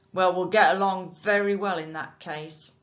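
loud, gruffly satisfied bargeman; loud bargeman pleased with the new boy; rough bargeman, pleased. A narrator's reading. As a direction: close the deal with the boy. Nick voiced in a story.

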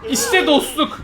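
very high, loud laughing cheer; joyful laughing shout. Real, not acted. Streamer cheering the teammate who saved him, high laughing shout. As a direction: whoop with delight at the rescue.

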